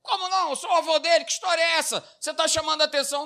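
loud, high outraged grandpa; grandpa indignant. Retold or imitated. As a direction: protest like an offended old grandfather. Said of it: "do vovô lá".

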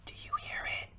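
breathy hushed whisper; barely voiced, secretive. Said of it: "Stage whisper, buddy".

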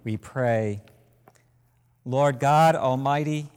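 ordinary talk

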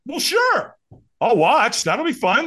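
high, chirpy acted enthusiasm; eager agreement. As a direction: jump at the easy way out. Acting out his eager agreement.

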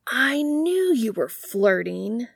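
high, slow, teasing exclaim; excited exclaim. A narrator's reading. Sam crowing at Dean about flirting, excited. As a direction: crow it out at his brother. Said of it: "Sam exclaims".

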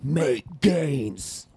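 hushed gym bro chant. Pretend. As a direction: whisper the gym mantra.

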